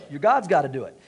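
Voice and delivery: normal talking voice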